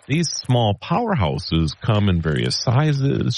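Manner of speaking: put-on old newsreel narrator. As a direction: read it like a radio feature. Scripted segment narration.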